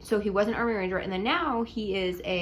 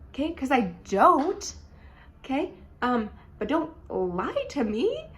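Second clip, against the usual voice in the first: indignant rant; 6 semitones higher than usual. Real, not acted. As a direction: vent that he lied about his height. Ranting about height lie.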